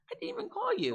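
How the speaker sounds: squeaky high exasperated protest; exasperated, high pitched. Retold or imitated. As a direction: squeal it out in disbelief. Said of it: "I'm like".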